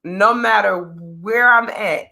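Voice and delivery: ordinary talk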